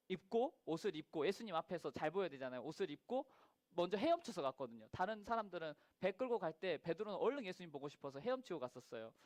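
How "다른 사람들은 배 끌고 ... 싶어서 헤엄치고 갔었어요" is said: ordinary talk, nothing marked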